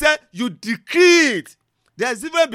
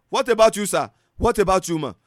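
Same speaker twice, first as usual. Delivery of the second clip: usual speaking voice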